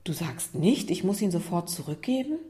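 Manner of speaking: softly incredulous question, rising at the end; surprised. Acted. Isa checks what Lukas really means, surprised. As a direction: check in mild surprise, lifting the last word. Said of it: "Isa sah Lukas überrascht an".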